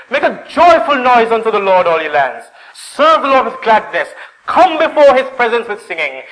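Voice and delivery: loud, high, exultant psalm; joyful proclamation. Acted. Worshipper singing on the way to God's house, exultant. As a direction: ring it out like a joyful noise. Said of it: "he's singing while he's going up".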